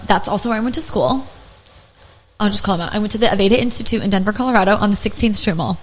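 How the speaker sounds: normal talking voice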